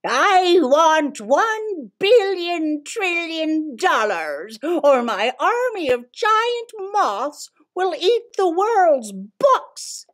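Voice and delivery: high, slow villain voice; villain demand voice. Acted. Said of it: "he makes his demand".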